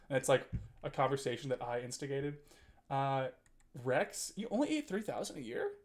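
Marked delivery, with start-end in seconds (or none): none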